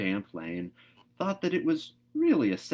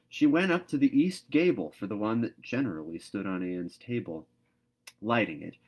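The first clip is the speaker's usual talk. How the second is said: usual speaking voice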